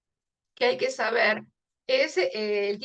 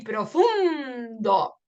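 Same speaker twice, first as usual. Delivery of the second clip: PROFUNDO with a long stressed U; slow down and lean on FUN in PROFUNDO; stressed vowel demo; much slower than usual. Shown as a demonstration. Teacher matching profundo to mundo, U stretched. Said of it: "en la U".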